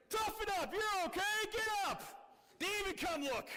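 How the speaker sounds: father yelling at his hurt son to get up; gruff dad shout. Retold or imitated. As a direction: shout down at the kid to toughen up. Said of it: "He's like".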